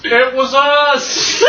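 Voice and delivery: high, drawn-out playful shout; mock shout. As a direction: shout it out slowly to the whole town, in play. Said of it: "I shout afterwards".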